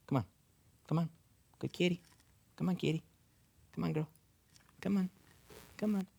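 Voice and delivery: soft coaxing call to a kitty; soft come-here call to a pet; coaxing a cat. Real, not acted. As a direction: gently coax the cat over.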